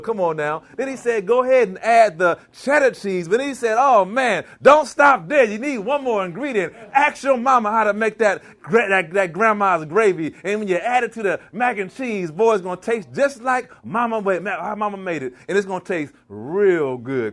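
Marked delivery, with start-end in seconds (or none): 1.3-3.3 voicing the Lord with a preacher's ring; preaching the Lord's words. Cook preaching recipe to class, a sermon-style proclamation. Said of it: "I can preach". 3.8-17.3 rising into a shouted preacher climax, then settling; preaching, building. Retold or imitated. Cook preaching the final ingredient to class, a rousing sermon. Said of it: "I can preach".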